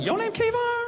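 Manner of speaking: high-pitched delighted disbelief; voice going up. Retold or imitated. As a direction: squeak the question way up high. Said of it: "his voice went up a little bit".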